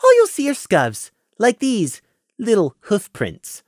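high, sugary mock explanation; sweetly mocking. Prey sweetly mocking Scenic about badger tracks. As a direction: tease him sweetly like a child. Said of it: "sweetly mocking the earth pony".

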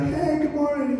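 high, friendly call in passing; called-out greeting. Retold or imitated. Preacher retells hailing an old acquaintance on his walk, called-out greeting. Greeting a man he walks past.

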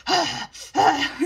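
loud high grunting; imitating angry grunts, loud and high. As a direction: push out two loud frustrated grunts. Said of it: "releasing angry grunts".

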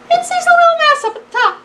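very high, quick squeal of narration; tiny high voice. A narrator's reading. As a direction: shoot way up high, loud and fast. Narrator sights tiny mouse high up.

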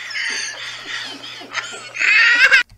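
high breathless silent laugh; wheezing laugh, out of air; laughing fit. Real, not acted. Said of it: "Is it really that funny".

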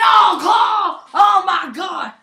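high and loud move call; thrilled play-by-play shout; excited shout. Real, not acted. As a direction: yell the move name like a ringside announcer. Top-rope move.

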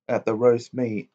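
ordinary delivery, nothing marked

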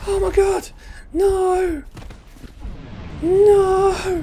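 very high, loud wail of dismay; dismayed cry. Real, not acted. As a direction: wail helplessly as it all goes wrong. Things go wrong in game.

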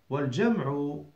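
usual speaking voice

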